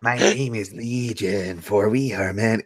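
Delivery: a light mock-sinister recital; ominous movie quote. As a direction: intone it like a demon. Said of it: "I say it frequently".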